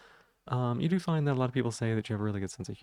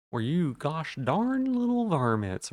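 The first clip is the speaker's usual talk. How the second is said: hammed-up slow higher cowboy drawl; cowboy bit; 5 semitones higher, 4 dB louder, slower than usual. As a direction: drag it out like a cartoon cowboy, louder. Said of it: "doing a bit".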